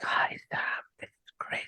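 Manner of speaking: breathy sexy whisper; ASMR whisper. Shown as a demonstration. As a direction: whisper it close to the mic. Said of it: "Some A S M R for you".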